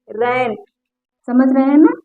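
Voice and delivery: ordinary talk, nothing marked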